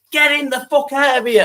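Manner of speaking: high, mocking tough-guy voice; mock the wannabe hard man with a high voice. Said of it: "trying to act that hard ... goes".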